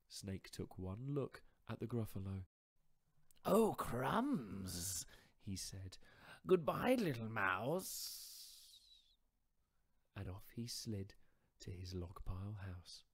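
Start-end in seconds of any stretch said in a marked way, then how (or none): 3.4-5.1 slow, drawn-out sly snake voice; slithery snake. Acted. Snake inviting the mouse to a feast, slow and sly. 6.5-9.1 slow, drawn-out sly snake voice; slithery snake. Acted. Snake inviting the mouse to a feast, slow and sly.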